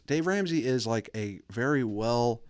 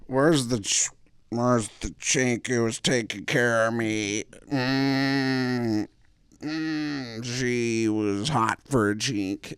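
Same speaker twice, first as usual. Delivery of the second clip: lightly voiced senile old man; 3 semitones higher, much slower than usual.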